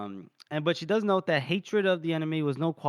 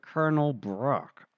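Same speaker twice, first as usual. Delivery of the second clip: slow, drawn-out put-on officer voice; hammed-up icy politeness; voiced stiff officer; slower than usual. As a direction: deliver the dismissal very slowly, stretching words. Voices Meade coldly dismissing the wife.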